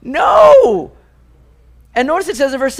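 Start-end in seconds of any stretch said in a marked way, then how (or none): 0.0-0.9 high, loud laughing cry of protest; a hammed-up horrified no; mock dismay. Congregation reacting to Satan's release, mock-horrified outcry. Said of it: "And we all go".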